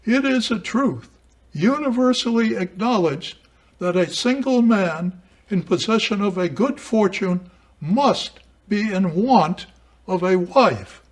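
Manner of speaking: high, loud, declaimed reading; literary quotation reading. Acted. Lecturer reads Austen's first sentence to show emphasis, declaimed high and loud. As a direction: ring it out grandly, well above your talking pitch.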